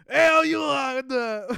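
laughing mock Cajun cop; loud Cajun drawl, breaking into laughs; Cajun accent. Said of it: "let me do my best Cajun accent".

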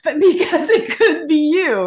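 slow words through a real laugh; chuckling as she teases the host. Real, not acted. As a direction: laugh it out slowly, teasing the listener. Laughs at her own joke.

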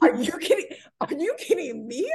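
high, loud, indignant retort; appalled disbelief. Acted. Woman throwing her date's question back at him, appalled. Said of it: "I am appalled right now".

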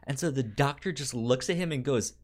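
ordinary talk

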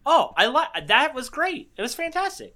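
high, gushing fake enthusiasm; mock gushing praise. Viewer gushing after the scare, in high mock praise. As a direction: overdo the praise like a starstruck fan. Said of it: "and you're like".

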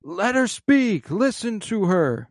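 high, slow mock shout of support; retold yell. Supporter shouting to defend Mon Mothma, a high, slow mock yell. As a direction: drag it out like a supporter calling across the chamber.

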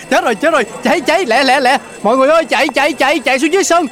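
high-pitched, repeated panicky cries; panicked urging. Acted. Manager rushing neighbours out of a fire, panicked.

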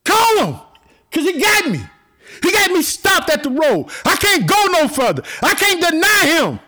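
high, loud cry with a sob in it; excited cry. Retold or imitated. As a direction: cry out that you can't go on. Crowd voiced, crying out.

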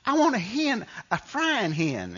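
very high, drawn-out Southern wail; heavy Mississippi accent. Retold or imitated. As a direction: imitate a distressed Mississippi mom, cartoonishly high and stretched out. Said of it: "Southern Mississippi accent".

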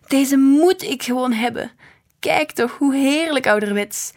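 high, loud gushing enthusiasm; excited. A narrator's reading. Woman wanting the snow globe, excited. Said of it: "riep ze opgewonden".

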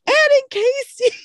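high-pitched squealed greeting; imitated excited fans. Listeners spot hosts in elevator, imitated excited squeal. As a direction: gush like a fan spotting podcast hosts.

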